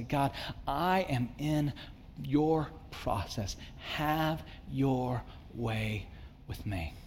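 soft and slow, letting go; quiet surrender prayer. Acted. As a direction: breathe it out humbly to God. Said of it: "would you invite, say".

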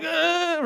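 high, strained mock groan, soft; tense inner groan. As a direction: squeeze out a groan of hidden panic. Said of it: "I'm dying".

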